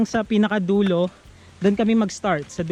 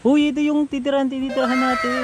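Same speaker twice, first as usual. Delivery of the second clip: high, louder, excited call-out; real excited high-pitched outburst; excited exclamation; 7 semitones higher, 3 dB louder, faster than usual. Real, not acted. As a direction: burst out on spotting a familiar place. Suddenly spots where they are staying.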